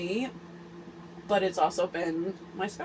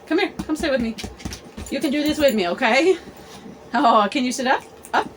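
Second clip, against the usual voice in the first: higher, coaxing pet-talk to a nervous dog; 5 semitones higher, 6 dB louder, faster than usual. Real, not acted. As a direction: coax the dog to sit with you. Talking to her anxious dog.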